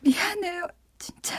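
soft broken whisper, close to tears; breathy whisper apology. Acted. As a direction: murmur it softly with regret. Sorry again, whispered. (울먹이며 속삭이는 사과)

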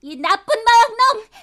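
furious, near tears; angry shout. Acted. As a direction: yell at him, voice cracking. Heroine scolds the dark lord.

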